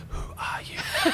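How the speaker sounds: breathy slow whisper with a laugh; awed hushed laugh; whispered awe. Retold or imitated. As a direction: marvel under your breath. Awed retold thought.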